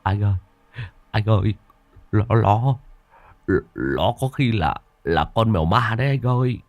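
scared, halting words with repeats; trembling stammer. A narrator's reading. Henchman tells his boss it's a ghost cat, trembling. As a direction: stammer fearfully between pauses. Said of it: "Thằng Đức miệng run run".

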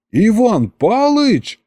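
high, drawn-out disbelief; stunned outcry. A narrator's reading. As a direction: jump up and cry the name, stretching it. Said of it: "вскочил я с лавки".

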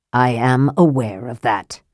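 mildly irritated, put out at being corrected; irritable. Acted. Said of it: "Raistlin said irritably".

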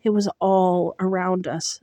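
ordinary delivery, nothing marked

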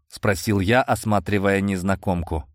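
ordinary delivery, nothing marked

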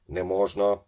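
loud urgent cry; shouted warning. A narrator's reading. Ben yells at the boy pulling the stick, shouted warning. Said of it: "крикнув Бен".

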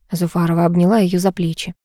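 usual speaking voice

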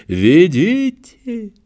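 high, very slow desperate cry; man down shout. A narrator's reading. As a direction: call out in a strange voice. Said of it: "закричал он не своим голосом".

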